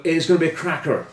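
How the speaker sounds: playful stage-Irish, "it's gonna be a cracker"; Irish accent. Pretend. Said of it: "that was my Irish accent".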